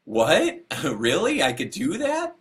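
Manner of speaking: high, incredulous questions, as if hearing unbelievable news; astonished retelling. Retold or imitated. Gorilla lover offered a volunteer spot, retold in amazement. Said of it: "she got teary-eyed and her mouth dropped".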